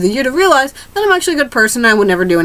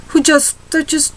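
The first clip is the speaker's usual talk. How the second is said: normal talking voice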